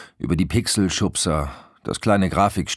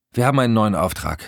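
fast, higher and eager; blurted announcement; 6 semitones higher, 4 dB louder, faster than usual. A narrator's reading. Husband breaking news of a job to his wife, blurted.

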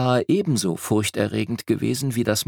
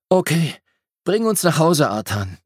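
put-on boy voice; 8 semitones higher than usual. A narrator's reading. Boy calls to the bear.

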